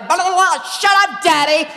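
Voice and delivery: high, loud mock tantrum; hammed-up shrieking outburst; imitating furious daughter. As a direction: yell it like a bratty girl fighting. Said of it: "trying to claw each other's eyes out".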